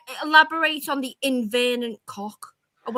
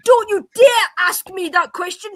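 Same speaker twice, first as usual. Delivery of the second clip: raised, laughing indignation; angry tirade; 6 semitones higher than usual. Real, not acted. Woman snapping back at the host over the joke, heated. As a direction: tear into him with mock outrage. Heated panel quarrel, shouting.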